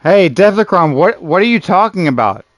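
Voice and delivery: ordinary talk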